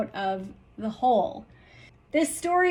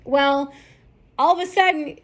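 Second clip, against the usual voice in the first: ordinary talk, nothing marked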